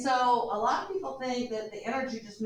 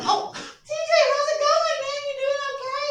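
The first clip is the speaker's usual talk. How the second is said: much higher, bright call to a friend in the hallway; hallway greeting; 13 semitones higher, 5 dB louder than usual. Retold or imitated. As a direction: greet a passing buddy cheerfully. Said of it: "and then".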